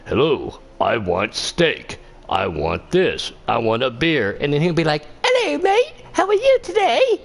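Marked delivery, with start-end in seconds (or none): 0.1-4.4 low, slow imitation of a big Texan; big Texan guy. Mocking what Texans expect from a big guy, low and slow. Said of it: "they'll expect this big guy to be like". 5.2-7.2 put-on Australian accent. Teasing the Aussie driver's real voice, high Australian accent. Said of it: "but not sound like a Texan".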